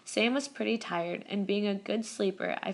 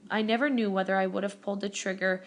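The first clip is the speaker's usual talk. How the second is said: ordinary delivery, nothing marked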